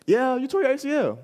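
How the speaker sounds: mock the doctor breaking the news; high voice with a laugh in it; doctor's verdict. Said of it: "And he's like".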